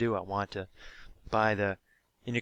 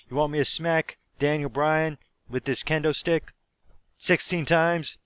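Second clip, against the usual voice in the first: higher, louder hammed-up taunting question; mock wrestler question; 5 semitones higher, 4 dB louder than usual. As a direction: ask the crowd like a showboating wrestler. CM Punk voiced asking crowd.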